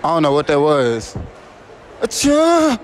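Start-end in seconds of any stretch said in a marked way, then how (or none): 2.0-2.8 loud, high fake sneeze; sneezing. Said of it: "who got the best sneeze".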